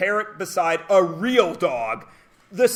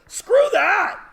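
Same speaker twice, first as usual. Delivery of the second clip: high loud scoff; indignant outburst; 12 semitones higher, 4 dB louder than usual. Real, not acted. Teacher ranting about small dogs, loud scoff. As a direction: throw it back hard.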